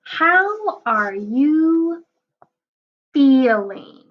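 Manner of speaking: slow, lilting storybook read. Acted. Teacher reading the book aloud to kids, slow storybook voice. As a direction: stretch each word for little listeners.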